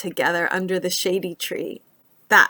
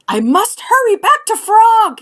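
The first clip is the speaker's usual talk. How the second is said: high, louder anxious cry; 13 semitones higher, 8 dB louder than usual. Acted. Toad frets about the melting ice cream, high anxious cry. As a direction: Toad calls out in a rush, worried. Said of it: "he cried".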